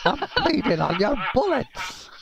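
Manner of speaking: high, slow mock psycho cry; yelling psycho. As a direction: echo the crazed taunt in a high voice, drawn out. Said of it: "hearing the psychos yell all kind of random".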